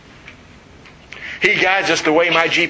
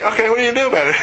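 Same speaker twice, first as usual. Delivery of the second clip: taunting; 9 semitones higher, much faster than usual. Retold or imitated. Taunting the GPS.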